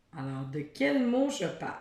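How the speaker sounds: ordinary talk, nothing marked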